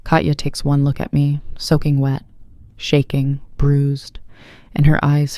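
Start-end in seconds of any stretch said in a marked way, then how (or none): none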